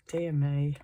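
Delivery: ordinary talk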